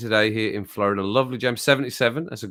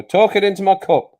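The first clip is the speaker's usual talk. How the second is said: echoing into a mug; into a cup; 8 semitones higher, 8 dB louder than usual. Real, not acted. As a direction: talk down into the cup.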